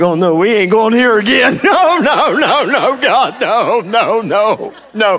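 high, loud laughing no no no; laughing protest. Retold or imitated. Preacher laughs off God's call to twins, protesting. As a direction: laugh while refusing God. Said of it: "I'm like".

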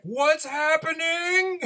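high, slow, bewildered squeak; stunned disbelief. Retold or imitated. Stunned onlooker watching his friend joke with gunmen, baffled. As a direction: stretch it out, utterly baffled and high. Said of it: "my brain is broken".

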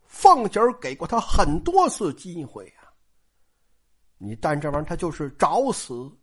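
plain reading voice

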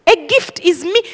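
ordinary delivery

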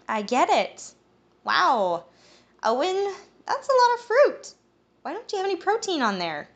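bubbly woman. Acted. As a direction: chirp at him playfully. Malia teasing about fruit.

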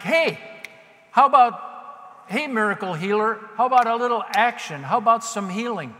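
loud, high, pushy call; entitled demand. Acted. Mock petitioner demanding healing from the miracle healer, entitled shout. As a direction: holler for service as if it's owed. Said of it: "with an attitude of entitlement".

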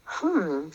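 soft musing hum; thinking hmm. Real, not acted. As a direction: hum while searching for words. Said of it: "I'm trying to think".

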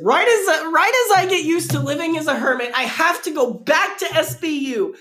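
high whiny mock of her tweet. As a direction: whine it like a bratty teen. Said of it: "you said".

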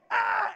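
loud high "Ah!"; short yell of alarm; panicked scream. Acted. Elijah fleeing the earthquake in the cave, acted-out scream.

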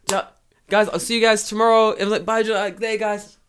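high, bright sing-song farewell; mock cheery goodbye. Teacher acts a cheerful goodbye to colleagues. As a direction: wave off the class breezily. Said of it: "I'm like".

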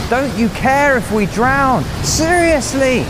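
high, loud and stretched-out plea; terrified disciple shouting at Jesus; desperate shout. Acted. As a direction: scream it at him over the wind. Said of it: "he shouts".

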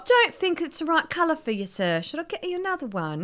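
high mock Leeds accent. Shop assistant advising a customer on colour, high Leeds accent. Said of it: "I used to be able to do the accent".

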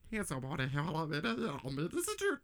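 close-up nonsense voice, squeaking at the end; talking dog gibberish. Retold or imitated. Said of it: "just really close and just goes".

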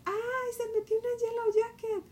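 high, loud panicked scream. Retold or imitated. A mother screaming to her sons about the wasp, panicked shriek. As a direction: shriek for help as the wasp gets in. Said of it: "empiezo a gritar".

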